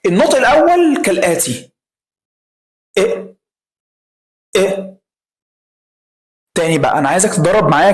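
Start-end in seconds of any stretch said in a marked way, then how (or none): 3.0-4.9 very short, quick "إيه" with no lengthening; short E sound. Shown as a demonstration. Teacher models the first pronunciation of E, short unstretched "إيه". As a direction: cut the vowel of "إيه" off at once, like a brief "eh". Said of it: "بسرعة كده، مفيهاش ولا مد".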